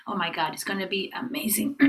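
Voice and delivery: ordinary delivery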